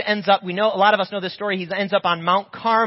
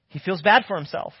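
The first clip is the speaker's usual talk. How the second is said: usual speaking voice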